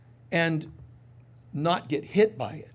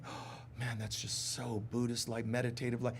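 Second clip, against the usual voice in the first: much softer, lower scoffing mutter; mock scoffing; 6 semitones lower, 12 dB quieter than usual. Skeptical listener scoffing at meditation, softer and lower. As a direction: scoff under your breath. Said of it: "some of you could be going".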